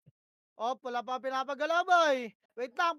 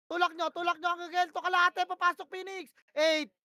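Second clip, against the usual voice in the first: ordinary talk, nothing marked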